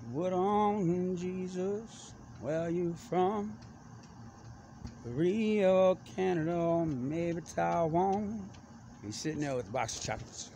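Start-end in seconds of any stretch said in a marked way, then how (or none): 0.1-8.5 high, slow, puzzled mock voice; dude's voice. Guy in the Northwest calling out, high and slow. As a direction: stretch it out, baffled and high. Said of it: "I hear some dude like".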